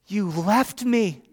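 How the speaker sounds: put-on high, drawn-out wail of a hurt child; mock weeping. Weeping young son blames his dad, imitated drawn-out wail. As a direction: imitate a little boy wailing accusingly. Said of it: "He's weeping".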